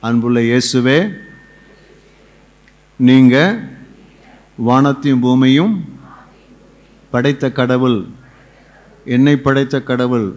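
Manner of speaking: phrase by phrase, with long pauses; led prayer. Real, not acted. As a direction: pray it out for others to repeat. Leads prayer for repetition. (இடைவெளி விட்டுச் சொல்லும் ஜெபம்)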